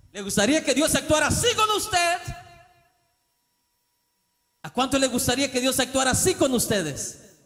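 high, loud shouted challenge; preacher confronting his listeners, loud challenge; preaching shout. Acted. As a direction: hurl the question at the pews.

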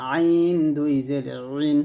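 "আইন" and "রিন" squeezed from the throat; pressed ع sound. Shown as a demonstration. Teacher drilling the letters, pressed throat sound in "আইন". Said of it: "আইন চাপ দিয়ে".

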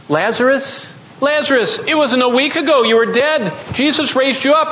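high-pitched, urgent challenge; impassioned calling out. Acted. Preacher challenging Lazarus over his silence, impassioned high cry.

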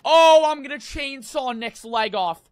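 loud, high-pitched shock; horrified yell. Real, not acted. Gamer about to chainsaw his leg off, horrified yell. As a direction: yell it out in real alarm.

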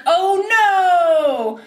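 high, drawn-out wail sliding down; hammed-up cry of despair; mock dismay. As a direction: overplay a horrified reaction. Acts Lori's dismayed reaction.

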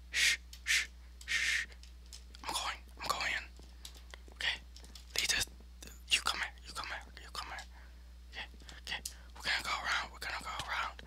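barely audible, voiceless whisper; stealthy whisper. Real, not acted. Player creeping round opponents, whispering. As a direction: whisper as if sneaking up on someone.

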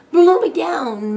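high, loud, drawn-out moan; exasperated pirate wail. A narrator's reading. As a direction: moan it slowly in dismay. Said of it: "moaned Jake".

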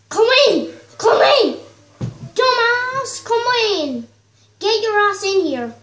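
high, loud impatient call; shouted summons. Pretend. Player ordering the dog inside, shouted summons. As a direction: call out sharply to a game dog.

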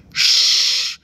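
long sharp hushing; urgent shush. A narrator's reading. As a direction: hush them fiercely. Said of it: "she cried".

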